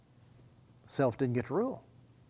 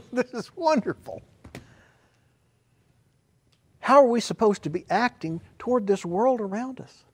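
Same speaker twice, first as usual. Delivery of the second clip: higher and louder joyful exclamation; exultant preaching; 11 semitones higher, 7 dB louder than usual. Real, not acted. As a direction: burst out with delight. Preacher rising to shout.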